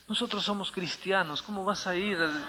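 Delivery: soft, anxious-husband imitation; hushed pleading. Husband stopping his wife at the door, hushed retold pleading. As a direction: drop your voice as the retold husband.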